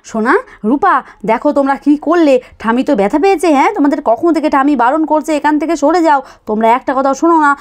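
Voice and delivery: ordinary delivery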